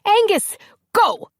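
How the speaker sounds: very high, loud frantic yell; screaming at him to run; desperate battle cry; urgent shout. A narrator's reading. Battle, urging brother to flee.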